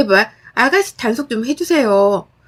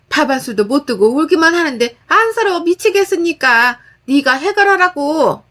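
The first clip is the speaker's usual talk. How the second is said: scolding mother-in-law; 4 semitones higher than usual. Acted. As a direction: tear into her daughter-in-law over the phone. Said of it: "욕 한 바가지를".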